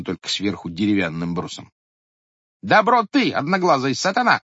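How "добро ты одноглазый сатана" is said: very high, loud furious outburst; furious cry. A narrator's reading. Sister-in-law attacking the one-eyed head, furious shout. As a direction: hurl the insult at him. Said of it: "вскричала она".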